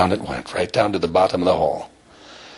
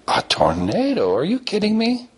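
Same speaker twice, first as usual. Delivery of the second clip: incredulous; 14 semitones higher than usual. Acted. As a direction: question it as the astonished grandmother. Grandmother reacts to unbelievable claim.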